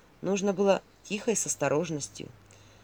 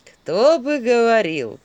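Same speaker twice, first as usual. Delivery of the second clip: very slow, loud mocking drawl; smirking; 4 semitones higher, 8 dB louder, much slower than usual. A narrator's reading. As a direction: stretch it out as a smirking comeback. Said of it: "усмехнулся Филин". (с усмешкой, громко и протяжно)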